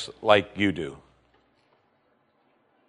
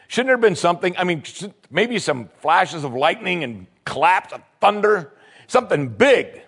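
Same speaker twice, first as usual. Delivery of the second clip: the offended general ranting; indignant Naaman; 7 semitones higher than usual. Retold or imitated. Naaman complaining the prophet snubbed him, indignant and high.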